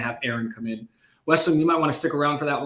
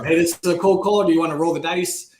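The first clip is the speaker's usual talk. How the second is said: higher, louder, bouncy sales pitch; upbeat pitch delivery; 4 semitones higher, 6 dB louder than usual. Retold or imitated. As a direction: sell "roll the dice" like a cheerful caller. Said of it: "you know".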